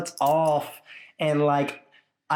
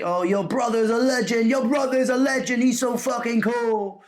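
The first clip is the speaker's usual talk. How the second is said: gushing fan; 10 semitones higher than usual. Retold or imitated. Fans gushing to brother over video call, excited high voice. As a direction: rave like a starstruck fan.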